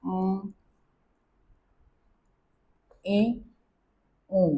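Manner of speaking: अ, ए, ओ sounded through the nose; French nasal vowels sounded through the nose. Shown as a demonstration. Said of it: "आवाज़ नाक से निकलनी है".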